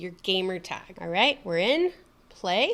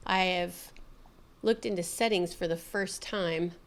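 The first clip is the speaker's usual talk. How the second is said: normal talking voice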